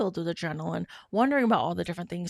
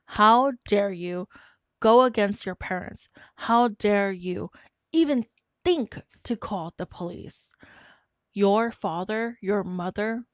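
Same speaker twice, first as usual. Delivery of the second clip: louder, slow, indignant scolding of a niece; retold angry yell; 2 semitones higher, 6 dB louder, slower than usual. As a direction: thunder it out as an outraged uncle. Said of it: "He yelled at her and he said".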